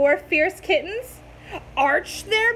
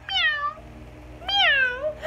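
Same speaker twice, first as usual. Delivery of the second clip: high little kitten meows; small high mewing kitten; 12 semitones higher than usual. A narrator's reading. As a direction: mew like a tiny kitten. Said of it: "She could only go".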